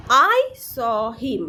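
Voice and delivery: very slow, word by word; deliberate classroom example reading; slow model sentence. Shown as a demonstration. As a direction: stretch each word out for learners.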